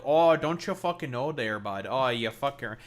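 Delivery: hammed-up Canadian accent demo. Shown as a demonstration. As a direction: show off a broad Canadian accent. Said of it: "versions of Canadian accents".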